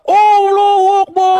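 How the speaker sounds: shouted high and slow; crowd shout. Retold or imitated. To his viewers, imitating the stadium crowd's shout.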